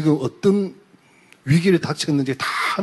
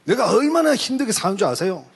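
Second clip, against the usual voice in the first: retold husband's grievance, higher-pitched; complaining husband; 7 semitones higher than usual. Husband protesting to those holding him back, aggrieved complaint. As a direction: plead the husband's case, voice raised in pitch. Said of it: "이 남자가 하는 소리".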